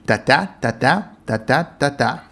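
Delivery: da-da, da-da tapped out with the second syllable stressed; iambic beat. Shown as a demonstration. Teaching viewers the original meter, tapping da-da beats. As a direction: count out the iambic tetrameter. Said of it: "iambic tetrameter with four pairs of syllables".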